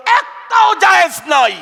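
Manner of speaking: shouted prohibition; preaching shout. Real, not acted. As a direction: thunder the ban to the crowd.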